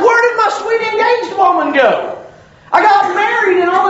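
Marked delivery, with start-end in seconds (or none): 0.0-2.2 mock bewildered husband. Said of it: "It's like".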